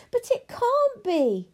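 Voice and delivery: high, loud, drawn-out protest; protesting child. Acted. Abilene rejects her grandmother's ending, upset. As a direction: wail the objection, pitched high.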